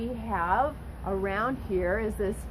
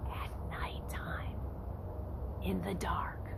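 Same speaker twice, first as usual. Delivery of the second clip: hushed and eerie, drawing them in; spooky whisper; 2 semitones lower, 9 dB quieter than usual. Acted. Teacher setting a scary scene for kids, whispered. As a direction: whisper it to build suspense. Spooky picture of dark barn.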